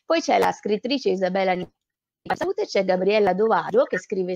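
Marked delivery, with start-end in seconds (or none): none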